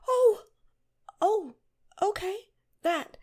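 high loud startled cries; surprised. Acted. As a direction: yelp in surprise.

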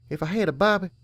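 put-on young filly voice. A narrator's reading. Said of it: "Serenity said".

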